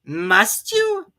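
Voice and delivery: courteously hint it's time to go; polite Rabbit. A narrator's reading. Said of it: "said Rabbit politely".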